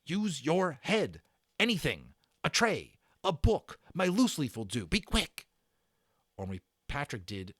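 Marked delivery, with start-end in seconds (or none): 0.0-5.5 fierce. A narrator's reading. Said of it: "Omri turned on him fiercely".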